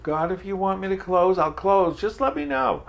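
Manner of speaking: venting at God on a walk, loud; angry prayer. Retold or imitated. Alone on a walk, pleading angrily with God, loud. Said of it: "hot under the collar and I'm red in the face".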